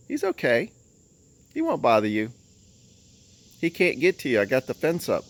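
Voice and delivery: soothing. Real, not acted. Calming scared chickens.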